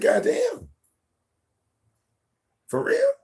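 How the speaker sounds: exaggerated dad disbelief; incredulous. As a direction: squeal it in disbelief. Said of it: "I'm like".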